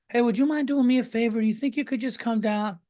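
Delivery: mock sweet request. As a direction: wheedle the favor out of them. Mimicking someone asking a favor.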